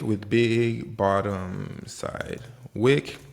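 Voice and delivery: very slow, each word stretched out; slow-motion repeat. Shown as a demonstration. Trader spelling out the pattern for viewers, very slow and stretched. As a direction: draw out "big bottom side wick" word by word. Repeats term very slowly for emphasis.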